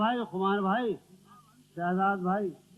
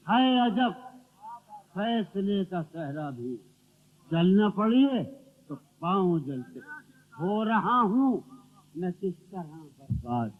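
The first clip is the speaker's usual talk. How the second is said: ghazal recitation; 5 dB louder, slower than usual. Acted. Poet reciting to the gathering, declamatory verse recital. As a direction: declaim verse with weighted pauses.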